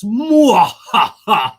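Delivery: loud slow villain laugh; evil cackle. Retold or imitated. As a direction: cackle like the Emperor. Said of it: "how was my Emperor impression there".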